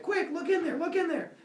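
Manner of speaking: high-pitched urgent pleading; acted urgency. Zeus with his head split open, urgent high plea. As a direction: hurry them along in a high voice.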